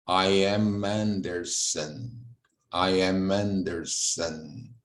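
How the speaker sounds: I am Anderson, slow, lips closing on "am"; closed lips on am. Shown as a demonstration. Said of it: "é falado com o lábio fechado". (fale "am" com os lábios fechados, devagar)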